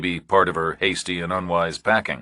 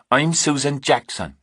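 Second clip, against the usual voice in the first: "I'm Susan Jackson" pitched higher in an unconvincing put-on English accent; fake English accent, insisting; 8 semitones higher than usual. Kate insisting on her false name to Reacher, unconvincing put-on English accent. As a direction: push the fake accent harder the second time. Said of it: "your accent isn't real convincing anyway".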